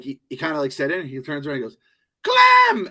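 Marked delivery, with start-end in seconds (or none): 2.2-2.9 high, loud joyful call of a name; excited shout. Retold or imitated. Old friend spotting him in the park, calling his name, excited shout. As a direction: yell the name out the moment you recognize him.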